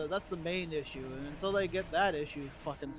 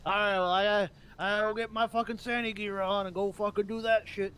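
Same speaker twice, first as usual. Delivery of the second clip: louder, higher, grumbling about the Santa gig; mock fed-up worker; 5 semitones higher, 7 dB louder than usual. Mocking city workers who quit, in a loud higher grumble. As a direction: imitate a bored worker dragging himself to a job. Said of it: "going on there just like".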